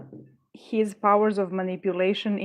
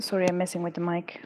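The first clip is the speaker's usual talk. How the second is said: usual speaking voice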